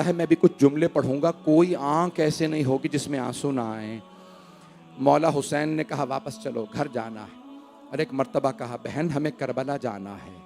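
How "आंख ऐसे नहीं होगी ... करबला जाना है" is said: hushed, much softer grieving narration; soft mournful recitation. Acted. Preacher reciting the farewell at the Prophet's grave, hushed and mournful. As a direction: draw the mourners in close with quiet grief.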